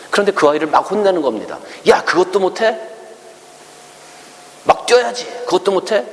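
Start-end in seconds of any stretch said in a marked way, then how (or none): 1.9-6.0 high, scolding rebuke from an angry dad; scolding father. Retold or imitated. Father scolding the child who can't run, high angry scolding.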